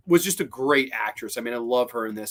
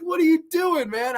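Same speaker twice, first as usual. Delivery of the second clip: high-pitched imitation of exasperation; genuinely upset. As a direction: demand it in mock dismay, voice way up. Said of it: "he gets so genuinely upset".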